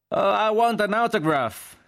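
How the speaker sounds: very high, eager soldier's yell; shouted. A narrator's reading. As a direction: yell it slowly from the crowd. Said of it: "gridò un G I". (grido acutissimo e prolungato)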